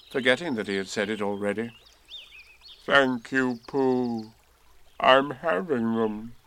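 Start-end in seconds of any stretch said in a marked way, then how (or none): 2.9-6.4 heavy, glum donkey voice; gloomy. A narrator's reading. Eeyore answering Pooh's birthday wishes, gloomy. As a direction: drag it out dolefully. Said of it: "said Eeyore gloomily".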